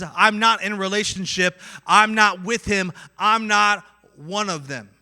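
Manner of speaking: loud, deliberate disowning; emphatic denial. Acted. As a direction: deny it firmly, each phrase weightier. Preacher voices Peter's emphatic denial.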